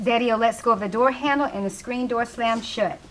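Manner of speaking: ordinary delivery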